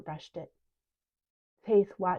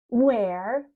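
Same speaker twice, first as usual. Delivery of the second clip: mocking; 4 semitones higher, 8 dB louder than usual. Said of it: "mocked Orange Eyes".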